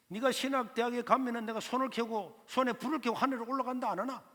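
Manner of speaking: 높은 목소리로 빈정대는 삼촌; 나가는 조카 뒤에 대고 비웃기; taunting uncle. Retold or imitated. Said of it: "그 삼촌이 나가는 저를 보고". (떠나는 조카를 향해 조롱하는 삼촌, 높게 빈정대듯)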